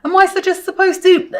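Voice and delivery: high, loud question cut short; sudden alarm. Retold or imitated. Vlogger re-enacting the sickness hitting, alarmed. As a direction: blurt it out in mock panic as it hits.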